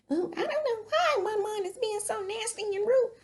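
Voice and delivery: hammed-up whine, mocking her complaint; mocking Whitney. Vlogger mimicking Whitney whining about her mom, whiny mock voice. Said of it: "she's like".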